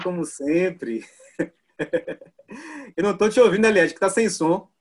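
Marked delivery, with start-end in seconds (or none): 3.0-4.7 loud laughing call to a muted guest; raised voice. Real, not acted. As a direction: speak up over a bad line.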